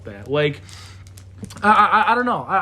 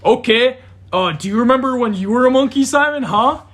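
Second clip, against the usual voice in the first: higher, louder mock questioning; mocking challenge; 7 semitones higher, 7 dB louder than usual. Challenging Simon about the monkey days, mocking. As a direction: needle him with a loud, high retort.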